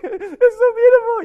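high, loud, slow comic bawl; hammed-up happy sobbing, stretched out; mock crying. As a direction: wail it like an overcome mother. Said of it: "Why are you crying, Mommy?".

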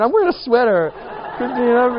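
high, loud, laughing words; laughing joke. Real, not acted. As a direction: crack up at your own joke. Joking about his weight.

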